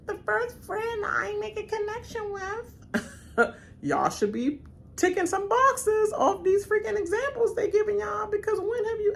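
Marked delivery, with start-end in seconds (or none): 0.1-3.6 high, slow, syrupy mock voice; mock sentimental Carolyn. Carolyn gushing about her first friend, high syrupy mock. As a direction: sigh it out like a lovesick friend.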